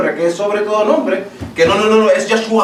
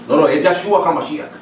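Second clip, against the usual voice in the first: a lightly voiced, lower, put-on devout preacher; mock pious praise; 3 semitones lower than usual. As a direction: gently parody a showy worship cry. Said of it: "pero allí dicen". (imitación burlona de alabanza)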